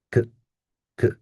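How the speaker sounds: "k" as a quick weak kuh; tiny clipped kuh for "k"; unstressed schwa. Shown as a demonstration. Teacher showing first unstressed syllable, short weak kuh. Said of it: "unstressed. So we say".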